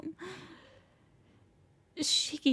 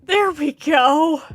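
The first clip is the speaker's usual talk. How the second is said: loud, high and triumphant; shouting; 8 semitones higher, 10 dB louder, slower than usual. Acted. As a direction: bellow it, then savour it. Climax of the chair chant.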